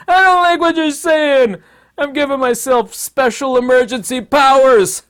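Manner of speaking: high, loud, hammed-up tyrant whine; mock despot. A despot giving himself special emergency powers, high and loud. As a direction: whine it like a petty dictator. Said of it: "That's what emergency powers are".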